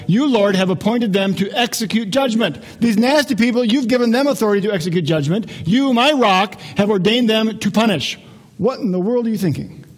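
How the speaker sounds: acted prophet's heated remonstrance; indignant complaint. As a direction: challenge God in disbelief. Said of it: "Habakkuk's got some more complaints".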